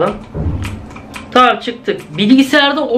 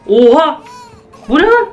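much higher, louder shocked cry; startled; 6 semitones higher, 3 dB louder than usual. Real, not acted. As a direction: jolt of fright at a giant toy. Huge toy suddenly appears.

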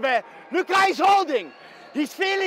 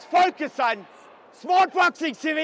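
ordinary talk, nothing marked

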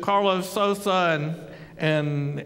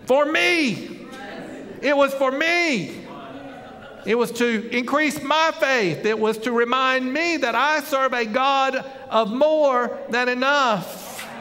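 preaching build; 4 semitones higher, slower than usual. Real, not acted. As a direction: let the revelation ring out to the church. Preacher's climax on faith.

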